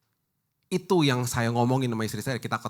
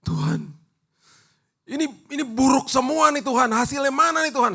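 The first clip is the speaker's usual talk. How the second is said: high, pleading whine at God; whiny protest to God, pitched high and loud; mock complaining prayer; 5 dB louder than usual. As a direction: act out a grumbling, accusing prayer. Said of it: "saya bilang gini".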